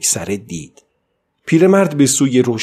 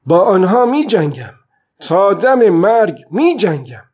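defiant vow; 7 semitones higher, 5 dB louder than usual. A narrator's reading. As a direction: swear it like a fighter who won't quit. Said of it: "پیرمرد گفت".

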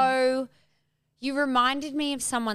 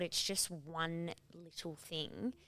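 calm reassuring; 5 semitones lower, 13 dB quieter, much slower than usual. Real, not acted. Calming advice, soft and slow.

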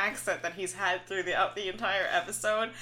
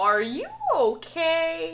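mock concern; 9 semitones higher, 3 dB louder, slower than usual. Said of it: "And then Zach's like".

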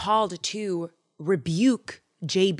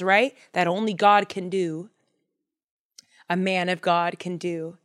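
normal talking voice

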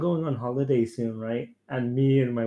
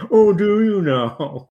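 slow, higher and louder mock wonder; sarcastic; 6 semitones higher, 6 dB louder, much slower than usual. Answering a smug parent's chamomile tea tip, sarcastic. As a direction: drag it out in fake amazement.

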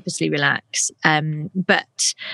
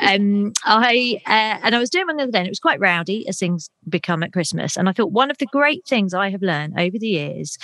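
ordinary talk

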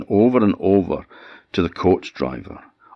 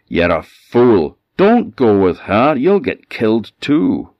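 warning shout; 5 semitones higher, 7 dB louder than usual. A narrator's reading. Driver warns him off.

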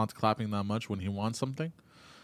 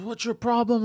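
angry; 10 semitones higher, 5 dB louder, slower than usual. Retold or imitated. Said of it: "She goes".

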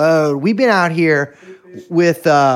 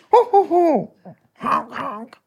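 silly high honking noises; imitated honk; 9 semitones higher, much slower than usual. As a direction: honk like a clown horn.

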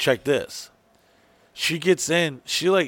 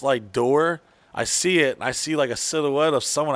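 ordinary delivery

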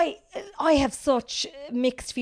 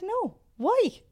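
much higher, surprised; puzzled nurse; 11 semitones higher, 4 dB louder than usual. Retold or imitated. Nurse puzzled by the new mother's worry, surprised. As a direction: sound genuinely baffled.

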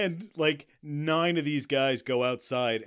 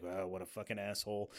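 lower, much softer flat remark; deadpan disbelief; 6 semitones lower, 9 dB quieter than usual. Retold or imitated. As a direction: mutter it with a head-shake. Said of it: "shakes his head like".

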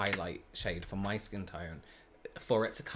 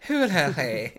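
put-on posh English accent; 4 semitones higher, 8 dB louder than usual. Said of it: "I try to sound like a posh Englishwoman".

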